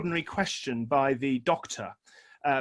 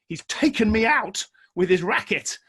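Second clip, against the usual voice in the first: mock indignant; 6 semitones higher, 6 dB louder than usual. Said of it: "Or I thought".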